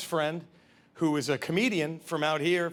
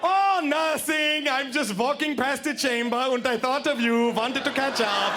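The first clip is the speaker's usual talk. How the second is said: sing-song friendly call, grotesquely casual; mock casual Nazi; 7 semitones higher, slower than usual.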